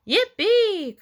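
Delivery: high, loud whoop; excited cry. A narrator's reading. Froggy thrilled by pumpkin contest, excited whoop. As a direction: cheer it out with soaring pitch. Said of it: "cried Froggy".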